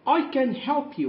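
slow, bright teacher read; slow modelling. Shown as a demonstration. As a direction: offer help slowly and clearly. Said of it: "هنا بتعرض عليها المساعدة".

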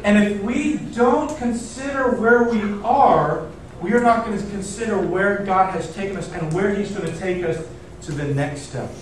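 ordinary talk

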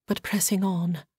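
ordinary delivery

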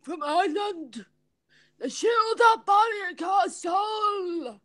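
high, drawn-out mock Irish accent; bad Irish impression, stretched and high; Jason. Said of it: "I'm doing an impression of you".

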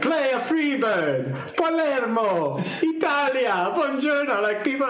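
high, slow hammed-up Italian accent; mock the crowd mocking him in Italian accent. Audience heckling the Sicilian guitarist, in a hammed-up Italian accent. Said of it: "mocking what he's saying, like, in an Italian accent".

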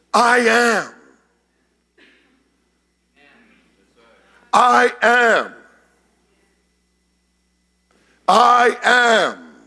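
ringing, loud proclamation; savored, resounding declaration. Acted. Preacher voices Jesus saying I am, resounding declaration. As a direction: declare it weightily, relishing the sound. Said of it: "I like the way it sounds".

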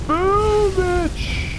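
very high, loud victory yell; triumphant shout. Acted. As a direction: scream the win. Triumphant kill in game.

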